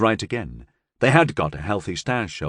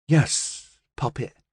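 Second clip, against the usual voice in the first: higher, mildly nervous servant's reply; 4 semitones higher, much slower than usual. A narrator's reading. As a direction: answer meekly, a touch nervous. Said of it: "Libby said nervously".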